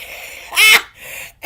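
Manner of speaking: high, loud breathless shriek of laughter; scream-laugh in delight; real shrieking laugh of surprise. Real, not acted. Shock laugh at seeing brother.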